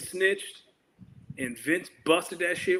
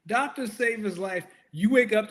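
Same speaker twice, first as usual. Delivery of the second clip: normal talking voice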